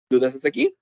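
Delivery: normal talking voice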